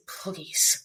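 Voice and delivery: whispered, breathless please. Retold or imitated. Friend imitating John's breathless please, whispered. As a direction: breathe the word out, begging. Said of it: "the breathlessness".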